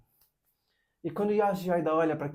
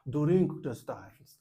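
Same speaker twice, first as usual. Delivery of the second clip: put-on very slow, louder English with a Japanese accent; 5 dB louder, much slower than usual. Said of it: "que ele é japonês, então ele dizia".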